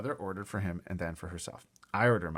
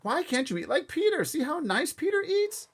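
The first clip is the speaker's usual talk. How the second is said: coaxing mother; 5 dB louder than usual. Acted. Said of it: "my mother told him".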